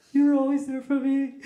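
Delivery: high-pitched hammed-up crying voice; mock sobbing. Maid of honor giving her speech, mock crying. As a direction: wail it high, as a mock tearful toast. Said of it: "She cried so much".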